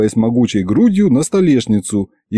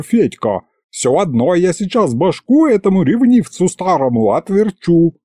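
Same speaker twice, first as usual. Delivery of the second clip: screaming; 8 semitones higher than usual. A narrator's reading. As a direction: scream at the old man. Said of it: "вдруг заорала". (заорала в ярости)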